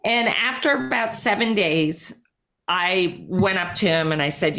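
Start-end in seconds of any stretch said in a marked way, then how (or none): none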